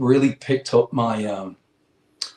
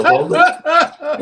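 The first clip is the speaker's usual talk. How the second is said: laughing explanation; 9 dB louder than usual. Retold or imitated. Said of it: "I go".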